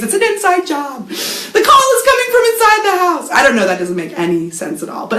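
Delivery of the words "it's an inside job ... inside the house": high, hammed-up thriller line; mock horror-movie panic. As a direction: play it like a spoof horror film. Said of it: "you're conspiracy theorizing".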